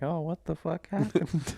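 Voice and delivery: lightly voiced dazed old lady; confused old woman. Mimicking confused old woman after crash.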